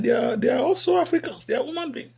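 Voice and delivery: normal talking voice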